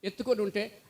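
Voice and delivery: ordinary delivery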